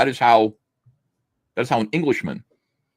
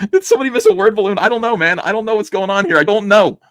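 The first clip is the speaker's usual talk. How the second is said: higher, louder baffled voice; a character wondering about a missing word balloon, baffled; mock confused character; 11 semitones higher, 6 dB louder than usual. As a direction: play him lost and puzzled.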